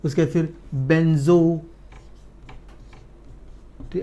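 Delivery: ordinary talk, nothing marked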